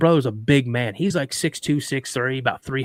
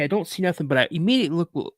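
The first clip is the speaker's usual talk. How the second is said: ordinary delivery, nothing marked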